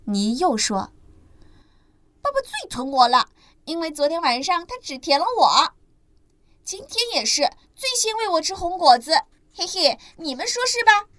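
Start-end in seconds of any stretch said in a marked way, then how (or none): 2.2-11.1 put-on much higher child voice, teasing; boastful little ankylosaur; smug baby dinosaur. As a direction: prove you're the favourite. Ni gloating to siblings.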